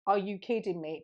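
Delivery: ordinary delivery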